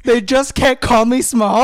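hammed-up country-accent impression; Rita's country accent. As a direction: mimic the caller's country accent with relish. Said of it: "She was like".

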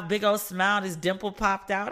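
normal talking voice

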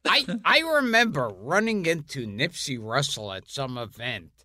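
ordinary talk, nothing marked

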